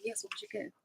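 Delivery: a breathless whispered question; excited whisper. Retold or imitated. Vlogger whispering eagerly to a friend about gifts. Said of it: "So I'm like".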